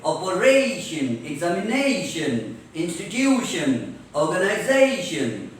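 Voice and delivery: OPERATION, EXAMINATION, INSTITUTION, ORGANIZATION leaning hard on the syllable before "-tion"; stress before -tion. Shown as a demonstration. Teacher drilling the rule, stretched stress before each "-tion". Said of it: "जोर से पढ़ते हैं".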